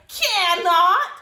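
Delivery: loud, high final cannot; yelled. Acted. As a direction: yell it to end the fight. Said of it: "she yelled".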